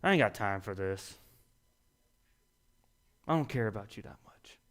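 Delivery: lazy, uncaring brush-off fading to a whisper; dismissive. Retold or imitated. Driver on a midnight snack run brushing off a stranded stranger, uncaring mumble. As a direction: shrug off the stranger, drifting quiet. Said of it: "I'm like".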